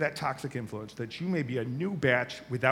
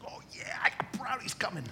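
put-on sneaky gleeful boy; 9 semitones higher, 5 dB quieter than usual. Boy gloating to himself.

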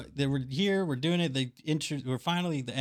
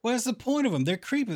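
incredulous; 10 semitones higher than usual. Retold or imitated. Host mocking the face-carving Numenoreans to Cody, high incredulous whine. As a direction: ask it like the idea is absurd. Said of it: "And I'm like".

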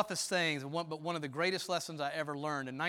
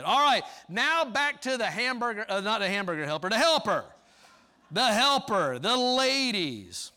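loud, higher preaching voice; preaching at full force; 5 semitones higher, 8 dB louder than usual. Acted. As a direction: build it like a pulpit crescendo.

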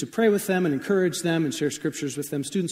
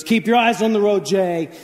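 mock warning; 3 semitones higher, 5 dB louder than usual. Teen scolds driving singer.

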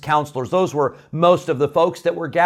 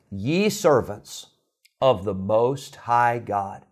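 slow, drawn-out, reverent words; solemn slow title; 4 semitones lower, 4 dB quieter, slower than usual. Retold or imitated. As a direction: give each word weight and time. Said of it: "he called out and he said".

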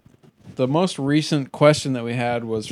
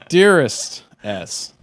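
formal letter voice; 2 semitones higher, 4 dB louder, much slower than usual. Acted. Reply letter read in formal tone.

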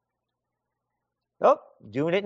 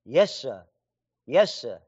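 lightly voiced meek lower yes sir; cowed worker; 9 semitones lower than usual. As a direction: answer like a beaten-down worker, a bit lower. Cowed worker answers official.

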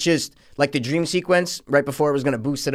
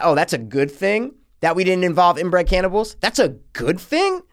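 incredulous questioning that climbs higher; indignant disbelief; 4 semitones higher than usual. Acted. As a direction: question it with pitch rising at each repeat. Mocks the writer's line in disbelief.

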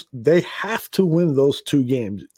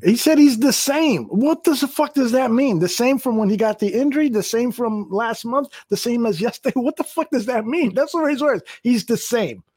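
high-pitched, incredulous rant; worked-up, raised pitch, firing off indignant questions; exasperated outburst; 8 semitones higher than usual. Real, not acted. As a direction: demand an explanation in high, heated disbelief. Exasperated rant at coach's vague answer.

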